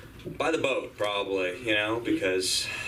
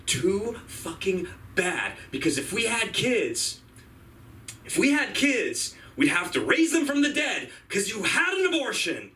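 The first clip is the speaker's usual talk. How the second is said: mock angry retort; 9 semitones higher than usual. Shouted punchline in argument with ex.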